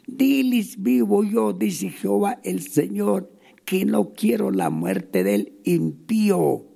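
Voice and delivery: preacher proclaiming to the house of Israel; preached scripture. Acted. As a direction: call the sinners to turn back. Reads Ezekiel 33:11 aloud.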